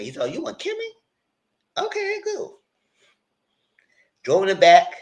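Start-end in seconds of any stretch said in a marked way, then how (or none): 0.2-2.6 squeaky high sarcastic okay; high put-on voice; high mock voice. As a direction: play it falsetto and fake-polite. Mock voice for club worker.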